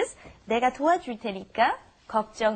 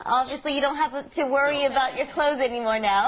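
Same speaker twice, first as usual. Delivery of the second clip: ordinary delivery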